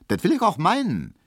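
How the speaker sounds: high, curt comeback; curt retort. A narrator's reading. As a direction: cut them off indignantly. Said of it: "gab Hedwig kurz zurück".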